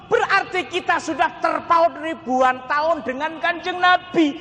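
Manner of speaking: high, ringing sermon delivery; impassioned preaching. Real, not acted. Preacher telling mothers of love for the Prophet, impassioned. Preaches the main point at force.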